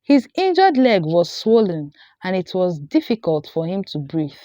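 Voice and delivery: ordinary delivery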